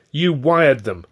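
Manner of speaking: indignant, falling charge; accusation. A narrator's reading. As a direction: accuse him outright, voice up. Accusing colleague of summoning the agents.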